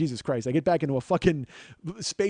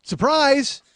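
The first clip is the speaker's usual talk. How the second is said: loud, bright surprise!; spring it on him like a prank reveal; a gleeful mock yell of surprise; mock shout; 7 semitones higher, 6 dB louder than usual.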